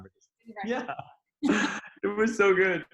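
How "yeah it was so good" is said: high, loud laughing delight. Real, not acted. Remembering the day, bursting into delighted laughter. Laughing at her own story.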